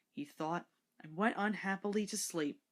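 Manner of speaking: ordinary delivery, nothing marked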